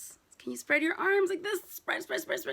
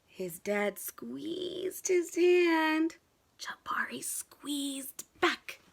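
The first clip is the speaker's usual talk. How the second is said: tender whisper; much slower than usual. Acted. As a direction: whisper it slowly and gently. Tender moment, whispered slowly.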